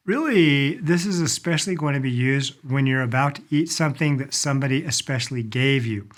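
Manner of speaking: ordinary delivery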